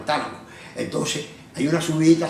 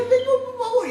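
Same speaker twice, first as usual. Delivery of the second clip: pleading. Retold or imitated. Begging Jesus, retold.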